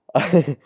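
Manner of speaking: high, sharp gasp of shock. Acted. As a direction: suck in a startled breath. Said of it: "he's just shocked".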